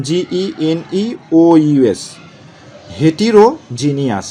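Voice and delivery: ordinary delivery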